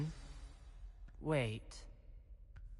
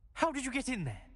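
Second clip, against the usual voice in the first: higher, accusing question; suspicious demand; 9 semitones higher, faster than usual. Acted. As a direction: demand an answer, voice rising in alarm. Confronts intruder at secret place.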